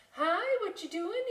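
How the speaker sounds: put-on high, curious child; chirpy little bat; little kid voice.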